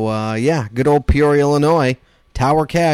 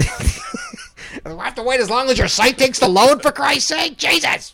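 high, exasperated outburst; mock outrage; 12 semitones higher than usual. Mock outrage at slow site.

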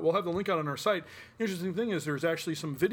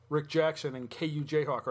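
ordinary delivery

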